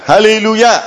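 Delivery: high-pitched Hallelujah call to the congregation; rallying acclamation. Real, not acted. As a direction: call it out high and sustained for all to answer.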